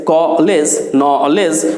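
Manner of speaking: ordinary delivery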